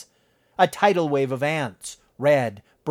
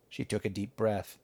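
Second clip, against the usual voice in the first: ordinary delivery, nothing marked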